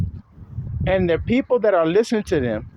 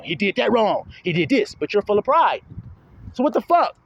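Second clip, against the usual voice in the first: ordinary delivery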